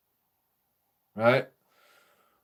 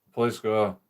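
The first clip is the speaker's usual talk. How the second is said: usual speaking voice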